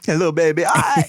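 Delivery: put-on high, cooing voice for a baby; baby talk. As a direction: coo at the baby. Greeting a baby.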